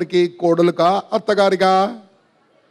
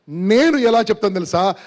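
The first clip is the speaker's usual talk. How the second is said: normal talking voice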